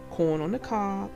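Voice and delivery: usual speaking voice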